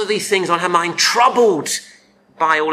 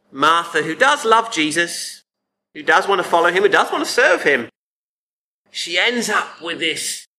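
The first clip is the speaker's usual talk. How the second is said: ordinary talk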